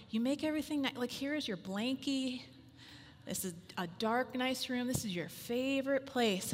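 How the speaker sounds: gentle bedtime hush; hushed soothing to kids. Retold or imitated. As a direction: murmur it like tucking kids into a nap. Mother settling twins for nap.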